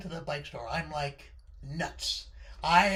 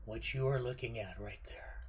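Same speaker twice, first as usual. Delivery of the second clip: hushed, breathless whisper of awe; awed whisper; 8 semitones lower, 6 dB quieter than usual. Acted. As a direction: whisper it like seeing treasure. Revealing the dream bike.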